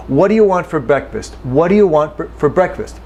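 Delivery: quick, with "do" and "you" reduced; relaxed everyday speech; normal reduced speed. Shown as a demonstration. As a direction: say it at natural speed, run it together. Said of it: "This is normal speed".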